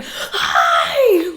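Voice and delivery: high, loud scream of a greeting. Retold or imitated. Daughter startled in a haunted house, screaming. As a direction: shriek it as if someone just jumped out. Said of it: "I immediately just start screaming".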